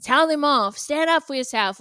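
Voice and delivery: mock hyping up. Speaker imitates friends glorifying a girl's toxic behaviour, hyped-up. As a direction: cheer a friend on, mockingly. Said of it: "glorifying it and being like".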